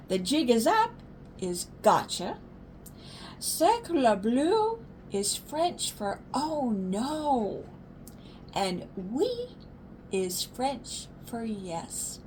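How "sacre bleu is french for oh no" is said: ordinary delivery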